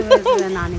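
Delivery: a high, sly little laugh. Real, not acted. Mother laughing at her clever trick with a sly high giggle. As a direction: chuckle at your own cleverness.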